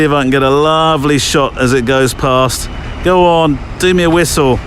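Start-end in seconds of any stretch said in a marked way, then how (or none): 3.0-4.6 calling out. Real, not acted. Calling out to passing steam train.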